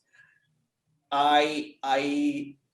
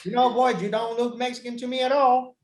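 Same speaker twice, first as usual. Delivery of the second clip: put-on distinctive Southern accent; 8 semitones higher, 4 dB louder, faster than usual. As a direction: play a Southern local sizing up a stranger, pitched up. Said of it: "this very distinctive accent".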